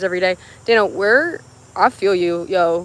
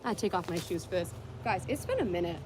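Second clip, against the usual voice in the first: far from mic; 14 dB quieter than usual. Real, not acted. Far from mic before trick.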